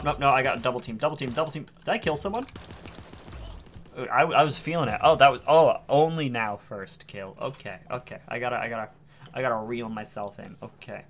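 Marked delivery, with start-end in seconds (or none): none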